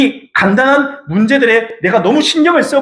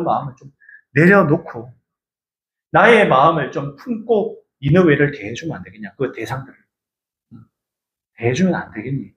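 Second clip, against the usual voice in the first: gentle plea; 5 semitones lower than usual. Acted. God pleading gently.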